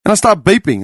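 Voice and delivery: usual speaking voice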